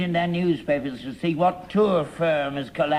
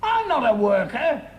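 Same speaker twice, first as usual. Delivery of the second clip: high-pitched, indignant protest; 7 semitones higher than usual. Acted. Father rejects his son's class-traitor charge, indignant. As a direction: throw the accusation back, voice jumping up.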